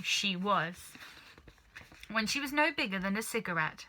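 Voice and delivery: ordinary delivery, nothing marked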